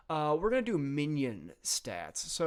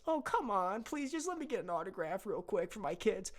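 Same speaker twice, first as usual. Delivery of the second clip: higher, softer begging fan voice; pleading superfan; 6 semitones higher, 3 dB quieter than usual. Acted. Fan cell begs the soldier for an autograph, high and soft. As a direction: wheedle for an autograph. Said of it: "fan cell pleads".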